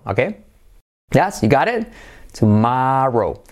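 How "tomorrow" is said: drawn-out "tomorrow" with open "ah". Shown as a demonstration. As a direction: slowly model "tomorrow" as tu-MAAH-ro. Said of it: "esa O es como una A".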